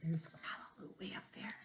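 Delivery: hushed whisper over the picture; whispered aside. Real, not acted. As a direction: lean in and whisper.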